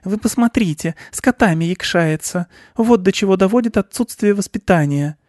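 high scandalized aunt voice; tut-tut in a high, scolding voice; outraged female shrew; indignant shrew aunt. A narrator's reading. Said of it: "подбоченилась рыжеватая крупная мышь-землеройка".

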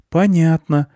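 put-on high child's voice; boy replying. Boy character replies to farmer.

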